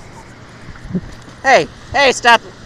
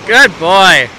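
reward the dog with your voice; dog praise; 6 dB louder than usual. Real, not acted. Owner praising the dog after a fetch, bright sing-song.

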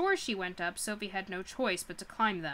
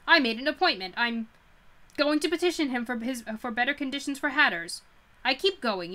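louder, fierce, trembling with anger and fear; defiant anger; 3 semitones higher, 6 dB louder than usual. Acted. Sophie defying the witch about the king, angry and louder. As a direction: spit it out to her face, furious but scared. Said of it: "trembling with terror and anger".

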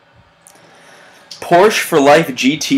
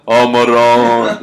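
slow, lightly Italian-American exclamation; slower than usual. Retold or imitated. Host reads a commenter's Italian exclamation. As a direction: draw out the Italian-American Maron.

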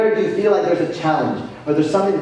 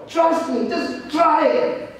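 gentle coaxing; 8 semitones higher, much slower than usual. Acted. God voiced gently coaxing.

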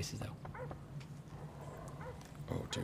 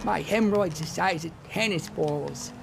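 higher, slow prank-call whine; 6 semitones higher, 5 dB louder, slower than usual. Acted. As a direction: ham it up like a crank caller. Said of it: "Do you know Jerky Boys?".

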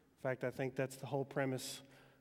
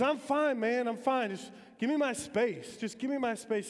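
defiant shout; 8 semitones higher, 5 dB louder than usual. Retold or imitated. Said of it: "He says".

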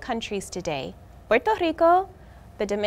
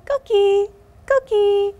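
coqui frog call; 5 semitones higher, 4 dB louder, slower than usual. Acted. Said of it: "they sing softly".

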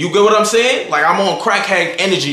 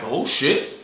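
low, subdued retold reaction; muted, winded acknowledgement; still winded; 4 semitones lower, 5 dB quieter than usual. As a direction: say it low and soft, barely up yet. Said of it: "I'm still winded".

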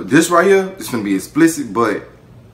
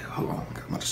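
whisper; 13 dB quieter than usual. Retold or imitated. She whispers in the car.

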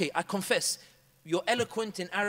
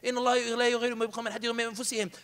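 fast recitation; 2 semitones higher, much faster than usual. Retold or imitated. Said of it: "I even used an ayah".

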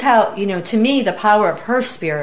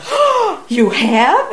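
high gasped oh, then louder; sudden high burst of surprise; astonished reaction; 10 semitones higher, 5 dB louder, much slower than usual. Retold or imitated. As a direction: react as if floored by the news. Retells her surprise at the tattooist.

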